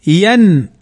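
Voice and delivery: ين as "yan", closed on a vowelless n; n with sukun. Shown as a demonstration. Teacher showing the silent-vowel noon, ين ending on n. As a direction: stop ين firmly on the n, no vowel after. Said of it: "نجدها ساكنة".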